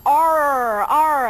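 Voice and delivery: American R with the tongue rolled back; American-accent demo of a heavy R in और. Shown as a demonstration. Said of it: "हमें अपनी टंग को रोल करना पड़ेगा पीछे की तरफ".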